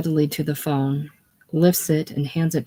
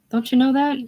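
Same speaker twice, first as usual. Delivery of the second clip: much higher, pressing the question; pleading, pitched up; 10 semitones higher, 3 dB louder than usual. Acted. Ralph urges George to see it, pitched high. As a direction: push it up high and urgent.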